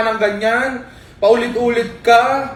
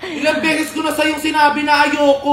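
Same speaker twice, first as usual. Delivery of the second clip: higher-pitched exasperated scolding; annoyed; 5 semitones higher than usual. Real, not acted. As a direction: scold a friend who won't listen, fed up. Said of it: "galit na galit ka naman masyado". (inis na paninita)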